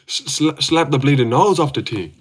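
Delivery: half-hearted Conor McGregor Irish accent; clumsy Irish fighter voice; Irish accent attempt. Shown as a demonstration. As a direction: have a go at Conor's Irish brogue. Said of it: "Do Conor accent?".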